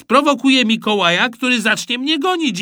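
put-on high, eager boy's voice; excited explaining. A narrator's reading. Boy explaining his move to supervisor, excited. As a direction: chatter on excitedly.

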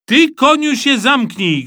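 loud, high angry retort; shouting. A narrator's reading. Captured boy snapping at Rufus, shouting. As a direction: yell him into shutting up. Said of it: "krzyknął Kleofas".

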